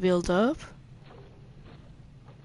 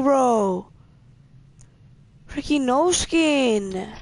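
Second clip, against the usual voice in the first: normal talking voice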